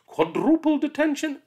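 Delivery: high, loud clipped verdict; stern teacher. Acted. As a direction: raise the penalty. Teacher escalates.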